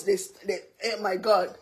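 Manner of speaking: ordinary talk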